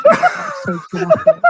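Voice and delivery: high squealing laugh through words. Real, not acted. Laughing through retold drama.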